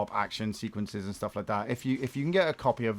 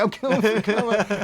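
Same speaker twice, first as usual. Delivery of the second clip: enemy voice; 7 semitones higher, 5 dB louder than usual. Retold or imitated. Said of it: "That guy was like".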